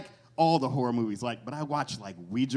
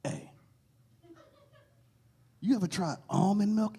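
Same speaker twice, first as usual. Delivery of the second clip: sneaky dealer; 8 semitones higher than usual. Retold or imitated. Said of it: "come up to you and shit all sneaky".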